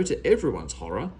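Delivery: plain reading voice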